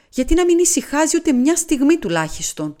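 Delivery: begging the pain to stop for a moment; anguished plea. Acted. Sick man cries out in pain. (απελπισμένη κραυγή πόνου, ψηλά και δυνατά)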